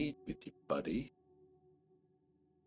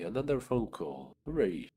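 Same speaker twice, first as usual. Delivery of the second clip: ordinary talk, nothing marked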